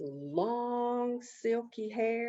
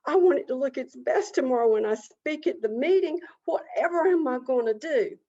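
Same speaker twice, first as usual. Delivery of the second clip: raised pitch, quick fretting; higher, dramatic possum dismay; fretting vain Possum; 4 semitones higher, 4 dB louder, faster than usual. Acted. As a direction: worry aloud over your looks. Possum frets about dirty tail.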